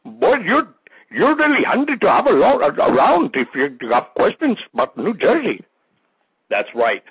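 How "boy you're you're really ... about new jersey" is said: high, squeaky caller voice; third fake caller. Last fake caller asks a silly question, high squeaky voice. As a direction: ask it like a daffy caller on the line. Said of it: "We got one more caller here".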